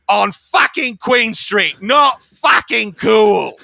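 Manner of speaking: slow, high, indignant mock shout. Annoyed rock star scolds a fan on the street, indignant mock shout. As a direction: drag out each word in an outraged put-on voice. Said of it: "he looks at me, and he goes".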